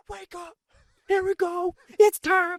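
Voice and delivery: very high mock scream, building in volume; Coach Wash. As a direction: imitate a coach screaming his room awake. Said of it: "he's screaming".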